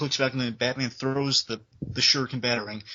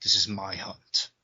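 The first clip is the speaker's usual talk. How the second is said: stern Batman; 3 semitones lower, slower than usual. Retold or imitated. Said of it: "he just gets there and says".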